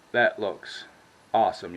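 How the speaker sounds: ordinary talk, nothing marked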